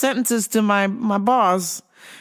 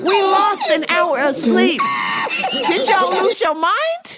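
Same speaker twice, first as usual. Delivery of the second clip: exasperated yell. Retold or imitated. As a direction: blow up in disbelief. Exasperated outburst at lady.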